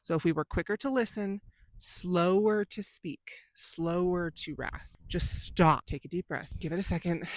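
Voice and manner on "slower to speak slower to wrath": slow, drawn-out "slower"; slowed right down. Shown as a demonstration. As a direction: stretch each phrase out deliberately. Stretches verse words on slowness.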